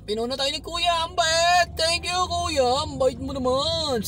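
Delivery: high, delighted gushing. Real, not acted. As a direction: gush over the kind stranger. Gushing thanks to kind driver.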